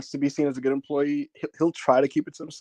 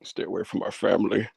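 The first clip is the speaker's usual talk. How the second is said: whispered, lower tough-guy movie line; mock action hero; 5 semitones lower than usual. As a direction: growl the one-liner like a mock movie hero. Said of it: "he's just like".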